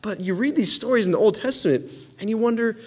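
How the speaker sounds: normal talking voice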